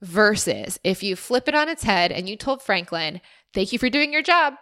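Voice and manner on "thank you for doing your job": high, bright praise as if to a good dog; congratulating praise. Retold or imitated. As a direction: cheer him on for doing his job. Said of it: "you say it in a positive tone that's congratulating him".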